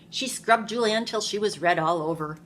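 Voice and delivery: ordinary delivery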